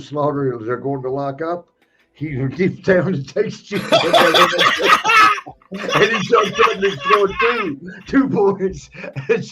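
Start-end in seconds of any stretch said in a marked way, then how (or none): 3.9-5.7 high, loud burst of laughter; storyteller losing it, shrieking laughter. Real, not acted. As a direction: cackle out at the punchline. Laughing at the retold finish.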